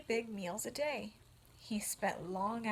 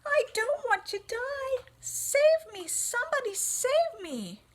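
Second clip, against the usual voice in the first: much higher, much louder cries; panicked pleading; 8 dB louder than usual. Acted. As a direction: beg for help in terror.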